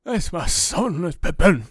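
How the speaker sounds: loud, slow, overjoyed cry; doting father. Retold or imitated. Father reunited with his son, loud and slow. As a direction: call out to your long-lost son. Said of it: "and he goes".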